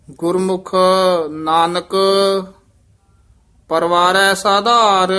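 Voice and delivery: measured, chanted scripture reading; scripture recitation with long pauses. Shown as a demonstration. Teacher chants Gurbani path.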